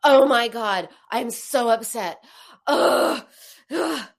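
loud, exasperated groaning outburst; feeling it. Shown as a demonstration. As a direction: let the upset out, ugh and all. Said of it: "Feeling it is".

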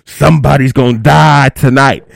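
slow, loud mock announcement to a crowd; imitating a man threatening the party; onstage threat. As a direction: draw out the threat, loud and menacing. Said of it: "grabs the mic from me, and goes".

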